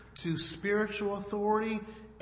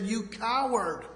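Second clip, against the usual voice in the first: imitating angry onlookers, higher and drawn out; slow, raised-pitch mock accusation; 5 semitones higher, slower than usual. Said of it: "the onlookers were angry at the lifeguard".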